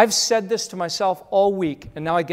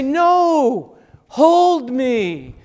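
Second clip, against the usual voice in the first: put-on higher, loud childish refusal; whiny child; 5 semitones higher, 9 dB louder, much slower than usual. Small child begging parent to hold them, whiny. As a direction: whine it like a tired toddler.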